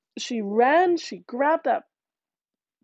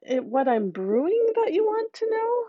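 high coaxing; 7 semitones higher than usual. A narrator's reading. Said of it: "So she says".

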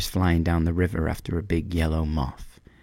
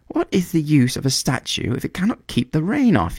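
much higher, put-out bird voice; indignant swallow; 9 semitones higher, 3 dB louder than usual. A narrator's reading. Swallow complains the statue keeps no rain off, higher indignant voice. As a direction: scold the useless statue.

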